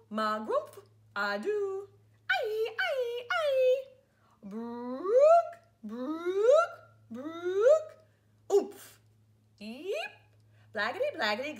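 loud, high goofy nonsense noises; silly sounds. Pretend. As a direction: ham up every nonsense word for the kids. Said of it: "make silly sounds like".

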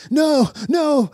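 high, mock-desperate cry; desperate protest. The herd refusing to be culled, mock-desperate protest. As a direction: plead it high, clinging on for dear life. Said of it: "but the herd is like".

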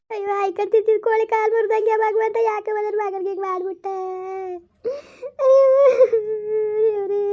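mother wailing to her sister; wailing to god. Acted. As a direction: cry out to god for the son.

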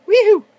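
high, loud whoop of glee; excited whoop. Real, not acted. Player cheering as the lure works, excited whoop. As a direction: let out a quick whoop.

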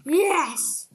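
loud, high yes of triumph; triumphant cheer. Real, not acted. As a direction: celebrate the win.

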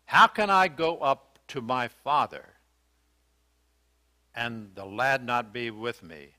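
normal talking voice

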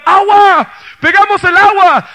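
loud, high jubilant cry; Isaac celebrating the water, loud and high; joyful shout. Acted. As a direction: yell it while jumping for joy. Isaac jumping for joy.

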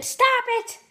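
high squeaky puppet yelling; squeaky ventriloquist dummy. Acted. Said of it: "I'm a ventriloquist".

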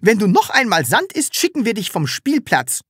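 mother threatening to send Sams away. A narrator's reading. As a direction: warn the child sharply. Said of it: "drohte eine Mutter".

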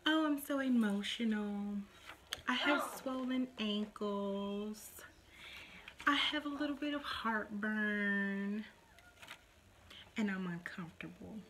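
put-on pity-me voice, stretching the last words; mock movie complaints. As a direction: mimic a dainty movie mom-to-be complaining. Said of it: "we've all seen the movies".

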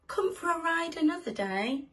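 high, loud cheery farewell; Mister Gumpy calling out. Acted. Driver inviting his guests back, calling goodbye. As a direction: call it out to departing guests.

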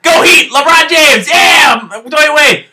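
rally the crowd for the Heat; cheering. Real, not acted. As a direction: fan shouting names in real excitement.

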